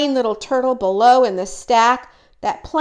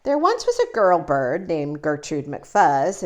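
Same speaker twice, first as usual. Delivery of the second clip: ordinary delivery, nothing marked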